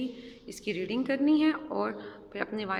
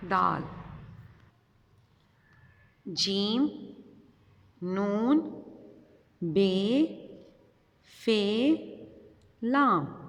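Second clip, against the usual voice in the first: letter drill; much slower than usual. Shown as a demonstration. Teacher calling letters for children to follow, drill-style. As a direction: spell it out slowly for the class to repeat. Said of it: "मेरे साथ reading करें".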